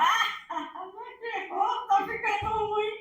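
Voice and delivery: put-on high, laughing taunt of a kid dodging a slipper; mocking kid. Kid taunting his mother after she misses, high laughing taunt. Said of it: "o filho fala".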